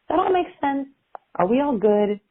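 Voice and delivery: high-pitched, light check-in; tentative high questioning lilt; gentle checking question. Shown as a demonstration. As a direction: ask lightly, pitched well up. Models a check-in question to listener.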